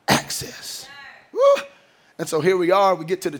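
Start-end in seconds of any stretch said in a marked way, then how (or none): 0.1-0.9 whispered ending. Acted. Sermon point ending. 1.3-1.6 high joyful "Woo!"; excited whoop. Real, not acted. Preacher's excited cry.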